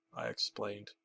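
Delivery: ordinary delivery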